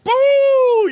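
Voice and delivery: mock boo. Sudden shout at viewer.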